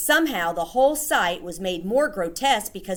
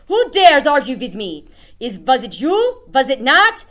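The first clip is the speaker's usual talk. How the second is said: menacing interrogation; 6 semitones higher, 11 dB louder than usual. Acted. Witch interrogating the objector.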